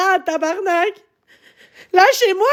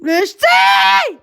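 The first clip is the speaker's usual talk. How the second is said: slow, stretched, much higher scream; anguished scream; 8 semitones higher, 3 dB louder, much slower than usual. Real, not acted. Streamer screaming at her harassers to leave her alone. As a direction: scream it out long and raw.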